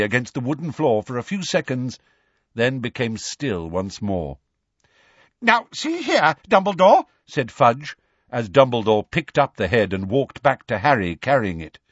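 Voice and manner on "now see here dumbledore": high, loud objection; indignant official, voice raised; blustering protest. A narrator's reading. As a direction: object with flustered self-importance.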